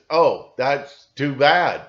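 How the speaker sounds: slow, loud, hollow mock sympathy; fake sympathy. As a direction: sound like a computer faking feelings. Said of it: "It's just".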